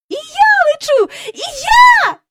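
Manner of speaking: high, loud excited shriek; girl's squeal. A narrator's reading. Jane suddenly lifts off the ground, squealing. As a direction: squeal it out at full pitch. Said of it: "заверещала Джейн".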